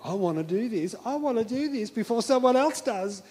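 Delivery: keen, rising urgency; David. Acted. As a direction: itch to go first, building from hushed to high. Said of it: "he was so enthusiastic".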